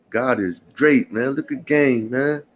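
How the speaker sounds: ordinary talk, nothing marked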